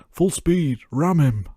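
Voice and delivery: loud, high South Yorkshire bellow; a Sheffield actor shouting in a South Yorkshire accent; shouted South Yorkshire. Retold or imitated. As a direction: bark the order in broad South Yorkshire. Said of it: "I'm gonna butcher the South Yorkshire accent here".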